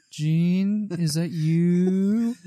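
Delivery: high, slow creepy call. Acted. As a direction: drag it out eerily. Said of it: "if I ever get a call center rep".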